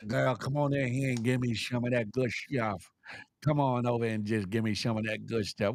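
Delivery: low husband voice, coaxing; calling husband. Acted. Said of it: "Ain't your husband calling out to you".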